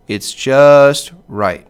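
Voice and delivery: very slow, savoring just right; drawn out. Shown as a demonstration. Teacher concluding pentameter is just right, drawn out. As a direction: stretch it out, satisfied. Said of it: "not too long, not too short".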